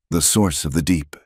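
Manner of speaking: ordinary delivery, nothing marked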